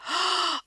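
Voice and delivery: high startled gasp; a quick intake of breath, caught out; sharp gasp. Acted. Told meat still in oven.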